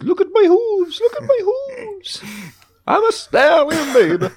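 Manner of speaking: very high squeaky cartoon donkey voice; high, hammed-up donkey boast; Donkey impression. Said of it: "where Donkey goes".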